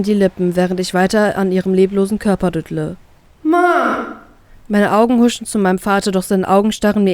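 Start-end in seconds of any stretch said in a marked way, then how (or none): 3.4-4.1 desperate cry. A narrator's reading. Finds mother lifeless.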